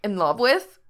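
loud, stunned sputter. Acted. Frank sputters at Gerard's news, shocked and loud. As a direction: blurt it out in disbelief. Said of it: "then sputters".

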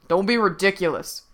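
loud scoffing outcry; crying out. A narrator's reading. Horseman rebukes the boy's bold suggestion, crying out. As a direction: cry it out, cutting the boy off. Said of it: "cried one of the horsemen".

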